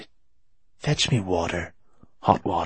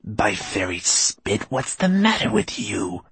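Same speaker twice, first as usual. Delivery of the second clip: put-on gruff, scolding old woman; rough; 3 semitones higher, slower than usual. A narrator's reading. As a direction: bark it impatiently. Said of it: "asked the little woman roughly".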